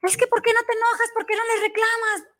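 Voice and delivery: mocking the coworker who asked why she never gets angry; young coworker. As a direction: pitch up high to play the pushy young coworker. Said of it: "me decía".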